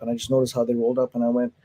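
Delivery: normal talking voice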